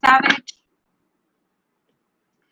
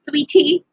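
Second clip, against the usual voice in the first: Sweetie drawn out with a teasing rise; stress on sweetie; 4 semitones higher than usual. Shown as a demonstration. Reader teasing a client about her name, stressed rise. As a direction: lean hard on Sweetie, rising. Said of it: "Emphasis on the sweetie".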